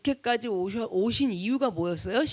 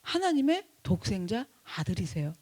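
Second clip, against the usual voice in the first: normal talking voice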